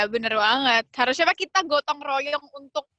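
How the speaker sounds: usual speaking voice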